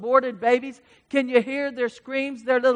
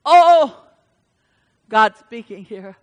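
ordinary delivery

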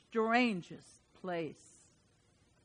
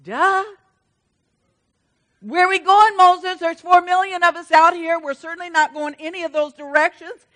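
sarcastic crowd; 7 semitones higher, 12 dB louder than usual. Retold or imitated. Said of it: "They're like".

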